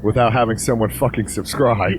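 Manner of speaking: ordinary talk, nothing marked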